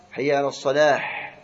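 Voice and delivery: الصلاح ending on a rough throaty ḥ; ha turned to ḥa. Shown as a demonstration. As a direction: end الصلاح with a harsh ḥa. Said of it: "إبدال هاء الصلاة حاء". (حي على الصلاح بإبدال الهاء حاءً)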